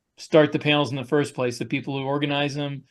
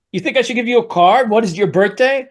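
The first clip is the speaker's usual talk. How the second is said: higher, louder tough-guy mobster voice; brash wiseguy challenge, pitched up and loud; hammed-up gangster bluster, louder and higher; Joe Pesci impression; 7 semitones higher, 7 dB louder than usual. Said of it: "I feel like Joe Pesci from Wise Guys".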